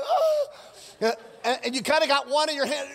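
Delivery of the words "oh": high, squeezed groan of effort; straining yelp; strained groan. Retold or imitated. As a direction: groan under the bar. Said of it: "you're just like".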